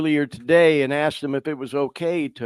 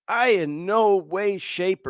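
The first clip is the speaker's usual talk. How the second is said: usual speaking voice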